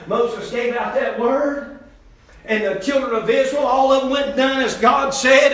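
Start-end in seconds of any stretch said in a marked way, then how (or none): 0.1-1.8 each word laid down slowly; slow, weighty repeat. Shown as a demonstration. Preacher repeating it slowly for the church. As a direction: let it land, slow and deliberate. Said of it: "Listen, church".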